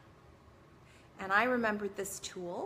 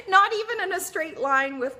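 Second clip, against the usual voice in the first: loud, high laughing words; 10 semitones higher, 10 dB louder than usual. Real, not acted. Telling viewers where the passport turned up, laughing.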